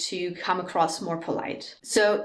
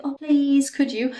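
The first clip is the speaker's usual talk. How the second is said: mock pleading, pitched high and louder; begging; 6 semitones higher, 4 dB louder than usual. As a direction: plead it in an exaggerated beg. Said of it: "that sounds like begging".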